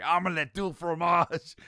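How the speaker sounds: loud, high, drawn-out angry yell; hammed-up angry outburst; mock angry.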